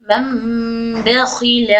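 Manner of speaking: मम बखील drawn out very slowly with a long nasal मीम; slow recitation demo. Shown as a demonstration. Teacher recites मम बखील slowly for students. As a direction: stretch मम बखील, humming the मीम before बा. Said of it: "नून की आवाज़ को मीम में बदल लेंगे".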